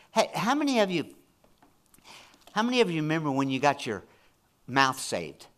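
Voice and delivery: usual speaking voice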